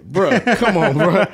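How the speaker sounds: exasperated laughing bruh; laughing disbelief. Retold or imitated. Friend laughing that Dre has no cable, laughing disbelief. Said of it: "I like".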